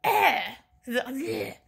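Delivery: wordless noises of irritation; too annoyed for words; frustrated grunts. Real, not acted. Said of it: "pisses me off".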